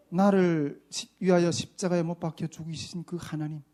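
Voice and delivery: usual speaking voice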